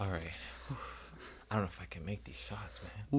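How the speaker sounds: unvoiced, fast nervous whisper. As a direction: whisper the doubt to yourself. Player mic'd up at line.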